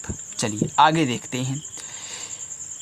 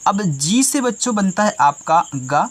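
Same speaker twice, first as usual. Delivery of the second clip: usual speaking voice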